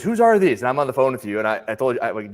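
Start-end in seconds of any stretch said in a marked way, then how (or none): 0.0-0.6 indignant woman. Retold or imitated. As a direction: imitate the annoyed shopper demanding answers. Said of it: "she goes".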